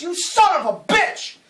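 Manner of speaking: high, loud outraged yell from the jury box; old man yelling. Acted. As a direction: scream it like a furious old juror. Old juror outburst in joke.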